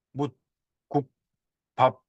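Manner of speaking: "묻, 국, 밥" each cut short on an unreleased stop; abruptly closed finals. Shown as a demonstration. Teacher showing how entering tones close, "묻, 국, 밥" stopped short. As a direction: shut each of "묻, 국, 밥" firmly, pausing between. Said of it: "입성은 딱 닫아야 되거든요".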